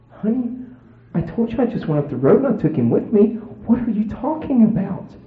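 high-pitched playing dumb; imitated clueless husband, pitched high; feigned innocence. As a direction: protest in a put-on innocent voice. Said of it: "acted like I had no idea".

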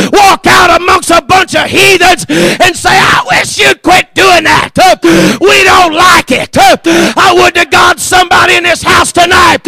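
high, loud, driving shouted cadence; fervent preacher hollering in rhythmic bursts; full-force revival preaching. Acted. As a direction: fire up the church with each punched phrase.

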